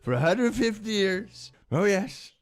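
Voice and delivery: lightly voiced boastful Trump; Trump impression. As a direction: brag like a cocky tycoon.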